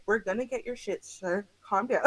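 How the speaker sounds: calm man soothing the angry passenger; calm reassurance. Retold or imitated. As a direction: settle him down gently.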